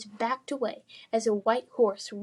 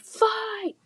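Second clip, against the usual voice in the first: yelled call; 12 semitones higher than usual. Acted. Kid calling out.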